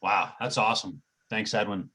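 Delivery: ordinary delivery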